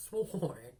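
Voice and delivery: ordinary delivery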